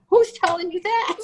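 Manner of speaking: getting pissed. Retold or imitated. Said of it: "I'm getting pissed".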